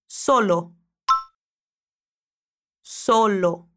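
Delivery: Solo with both o sounds closed, as in avô. Shown as a demonstration. Teacher models the Spanish O for the student, closed o. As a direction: say Solo with a round closed o, never open. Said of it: "que é sempre como em avô".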